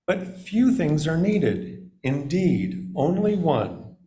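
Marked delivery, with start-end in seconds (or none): none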